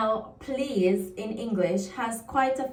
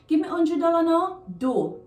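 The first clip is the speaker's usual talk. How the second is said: higher, louder Jamaican patois line; 8 semitones higher, 5 dB louder than usual. Shown as a demonstration. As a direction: say it as a Jamaican would, brisk and bright. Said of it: "the do here means please".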